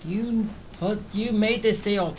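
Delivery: usual speaking voice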